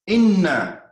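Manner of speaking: "Inna" said loud and high with a doubled n; Arabic inna. Shown as a demonstration. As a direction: stress the word "Inna" with a long held nn. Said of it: "In the Arabic language, whenever you see "inna".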